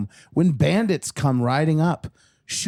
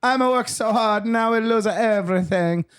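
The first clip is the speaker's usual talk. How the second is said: high mock-weeping Italian accent; hammed-up sobbing in a comic Italian accent; crying Italian farmer; 9 semitones higher than usual. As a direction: wail it like a broke Italian papa. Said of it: "The Italian farmer is crying his eyes out".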